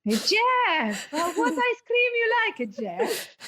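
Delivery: high sing-song talking-down voice breaking into laughter; mock the waiter's sugary tone through giggles; patronising waiter, laughing. Waiter fussing over Ollie called Jeff, high patronising voice through laughter. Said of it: "he was being really patronising".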